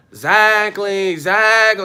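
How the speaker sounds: loud neighbor quote. Retold or imitated. Neighbor yelling in the hallway, mock loud shout. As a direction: belt it like you're yelling through a hotel wall. Neighbors arguing through hotel wall.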